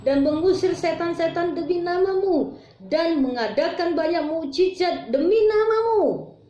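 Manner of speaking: high, pleading Tuhan, Tuhan, bukakan; desperate begging. Acted. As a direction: beg at the closed door of heaven. Quoting desperate followers begging. (memohon putus asa dengan suara tinggi)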